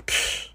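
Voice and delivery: short, soft hissed "psh"; dismissive psh. Retold or imitated. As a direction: brush them off with a psh. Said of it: "first he goes".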